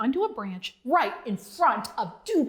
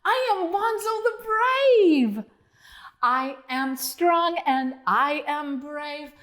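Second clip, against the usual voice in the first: triumphant; 4 semitones higher, slower than usual. Acted. Bonzo's triumphant declaration.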